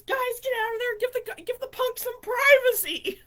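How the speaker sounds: high-pitched imitation of an exasperated brother; bossy telling-off. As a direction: re-enact shooing everyone out. Brother orders friends out in story.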